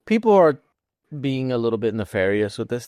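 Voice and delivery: ordinary talk, nothing marked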